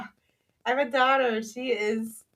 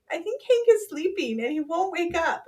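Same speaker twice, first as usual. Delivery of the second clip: put-on little girl's worried voice; 7 semitones higher than usual. Daughter voiced in story.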